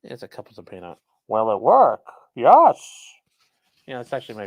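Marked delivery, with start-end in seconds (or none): 1.3-2.8 into a cup. Shown as a demonstration. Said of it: "see if I can use a cup here".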